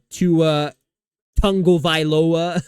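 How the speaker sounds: heavy, slow stress on Tagovailoa; mock over-emphasis on Tagovailoa; Cam Newton. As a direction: punch out Tagovailoa syllable by syllable. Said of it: "he very heavily emphasizes".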